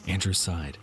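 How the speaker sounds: ordinary delivery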